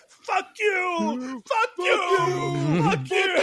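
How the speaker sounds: screaming high-pitched fury, hammed up; Travolta meltdown yell. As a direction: shriek it like a man losing his mind. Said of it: "And he goes".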